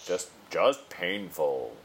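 low, slow, sultry drawl. Shown as a demonstration. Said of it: "Sorry, with a sultry voice".

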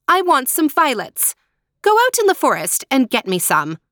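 spoiled sister commanding Marushka, sharply; sharp order. Acted. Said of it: "she ordered sharply".

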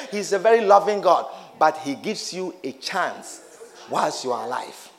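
ordinary talk, nothing marked

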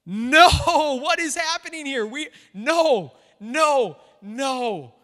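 high, loud, laughing "no, no"; a flustered apostle waving them off, half laughing; alarmed protest. Retold or imitated. Paul and Barnabas stopping the crowd, mock alarmed shouting. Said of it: "they're like".

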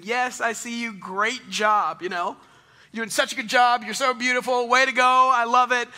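loud, high, encouraging cheers; excited cheering. Retold or imitated. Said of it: "I keep saying".

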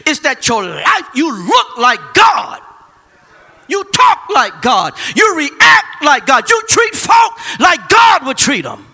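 slow, loud, rising preacher shout; full-force preaching. Real, not acted. Preaching climax.

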